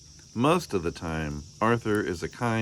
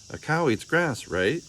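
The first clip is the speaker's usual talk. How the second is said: put-on child's voice; 8 semitones higher than usual. Child Arthur voiced in story.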